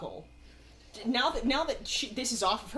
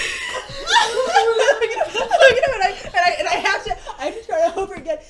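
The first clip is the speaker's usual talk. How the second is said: higher, louder helpless laughter through words; higher, louder real laughter; laughing hard; 10 semitones higher, 13 dB louder than usual. Real, not acted. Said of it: "I'm laughing this hard".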